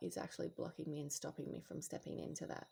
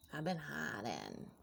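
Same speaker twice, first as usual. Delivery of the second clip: slow confession; slower than usual. Acted. Repeats confession, slowing down.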